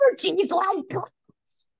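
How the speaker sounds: high, slow, starstruck gush; imitating a fan gushing at the speaker; mock gushing woman. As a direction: swoon over him, hammed up. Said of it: "this woman stands up ... and says".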